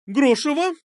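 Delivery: high, startling bark of a surname. A narrator's reading. Medusa makes silent girl flinch, barking. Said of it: "рявкнула Медуза".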